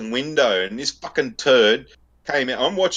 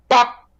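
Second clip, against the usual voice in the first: sudden high, loud imitated horn blast; loud mouth-made horn honk; horn bop; 14 semitones higher, 6 dB louder than usual. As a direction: blurt out a car horn noise. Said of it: "I just go".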